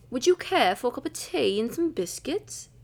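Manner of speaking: put-on British, question lifted at the end; British accent. Shown as a demonstration. Outdoing a friend in the challenge, British accent. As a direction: do a tea-time British voice. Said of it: "First one, British".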